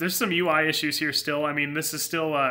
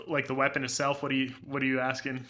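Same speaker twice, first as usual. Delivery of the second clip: ordinary talk, nothing marked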